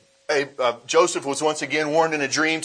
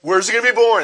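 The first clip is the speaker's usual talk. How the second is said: loud, higher demanding question from a king; Herod demanding; 8 semitones higher, 7 dB louder, faster than usual. Retold or imitated. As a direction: demand it like an anxious tyrant. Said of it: "What did he ask him for?".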